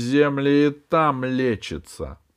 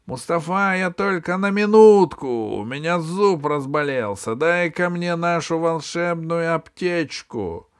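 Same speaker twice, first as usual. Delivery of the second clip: shouting into bottle; 7 semitones higher, 5 dB louder than usual. Acted. Джинн кричит в бутылку.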